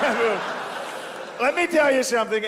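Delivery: teasing, high, mocking comfort; mock soothing a crybaby; mock consoling. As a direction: talk down to him like a baby, laughing. Mocking a crying dictator.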